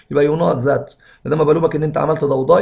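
usual speaking voice

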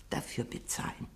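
whispered condition; old lady whispering that he must pay a lot. Acted. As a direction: whisper the price condition slyly. Old lady naming her condition.

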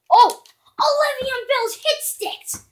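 high, loud, drawn-out outcry; shocked yell. Real, not acted. Gamer watching his runner get flattened, shocked yell. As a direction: cry out at the hit.

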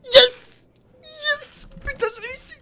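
high loud victory shout; real joyful scream; triumphant yell. Real, not acted. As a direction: cheer the win. Beats the boss.

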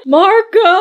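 high sing-out call across the pool; game call. Pretend.

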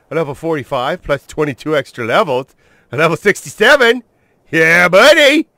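from upbeat to a high, loud cheer; building excitement. Real, not acted. Gamer cheering his new dino's level, building to loud and high. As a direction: count it up and celebrate.

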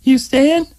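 put-on high, light girl's voice; young woman voice. A narrator's reading. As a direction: voice Vicky, lightly asking. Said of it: "she said".

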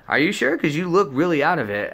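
lightly imitated high-pitched concern; worried friend. Voices worried friend pressing him.

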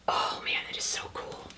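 hushed whisper of amazement; awed whisper. Real, not acted. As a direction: whisper it like a secret treasure. Whispered awe at shirt.

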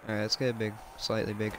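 ordinary talk, nothing marked

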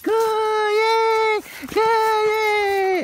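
very high, loud, gushing dog praise; excited praise. Real, not acted. Trainer praising Bowie after a good recall, high and excited. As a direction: cheer the dog on like a delighted owner.

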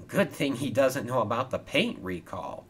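plain reading voice

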